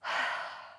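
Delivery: audible exhale of letting go; long releasing sigh. Shown as a demonstration. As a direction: sigh it all out. Models letting go in meditation.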